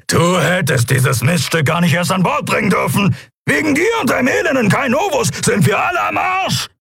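high-pitched angry shouting; enraged and strained; furious blaming. A narrator's reading. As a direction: rant at the captain, climbing to a yell. Kero rages and blames captain.

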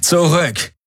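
high-pitched sharp order; commanding. A narrator's reading. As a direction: order the intruders back. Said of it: "befahl Tari".

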